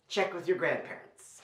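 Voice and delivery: usual speaking voice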